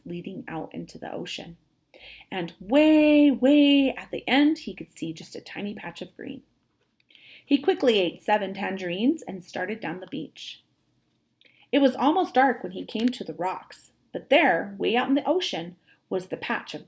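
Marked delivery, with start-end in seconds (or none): none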